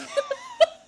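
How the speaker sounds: very high laughing shriek. Real, not acted.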